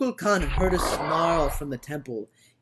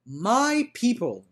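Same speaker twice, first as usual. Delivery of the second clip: magic voice; 7 semitones higher, 4 dB louder, slower than usual. Acted. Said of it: "His voice was magic".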